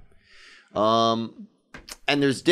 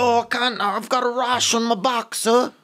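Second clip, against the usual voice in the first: high Cockney voice, pleading; hammed-up high Cockney whine; mock Cockney beggar; 10 semitones higher than usual. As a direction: play a poor Cockney street urchin begging, pitched way up. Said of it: "that's more like street".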